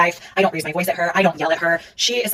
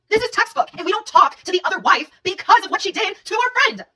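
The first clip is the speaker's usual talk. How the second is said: much higher, worked-up voice; incredulous indignation; 12 semitones higher than usual. Real, not acted. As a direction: pitch it way up, pressing the point. Indignant argument about the betrayal.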